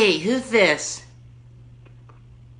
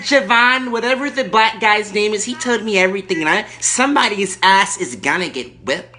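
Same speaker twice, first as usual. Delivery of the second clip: high, loud irate lady caller; Erkenbacher; 4 semitones higher, 4 dB louder than usual. Acted. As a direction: play the furious entitled lady on the phone. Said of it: "Erkenbacher calling back".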